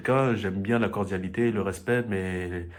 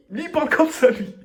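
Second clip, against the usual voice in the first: laughing; 9 dB louder than usual. Real, not acted. Laughing about father's look.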